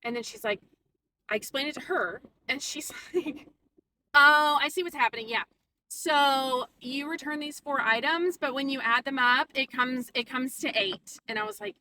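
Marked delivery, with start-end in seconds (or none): 4.1-11.2 condescending explaining. Retold or imitated. Mimics Mel explaining condescendingly.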